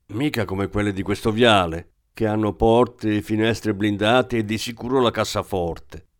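ordinary delivery

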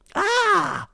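very high sharp cry of pain; a small boy cries out when an elbow hits his braces, a high yelp; child's pained yelp. Acted. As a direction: yelp like a boy just struck. Boy hit in the mouth.

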